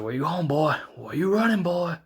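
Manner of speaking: impersonating the Undertaker taunting A J. Retold or imitated. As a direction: call after him like a predator toying with prey. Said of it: "Taker's like".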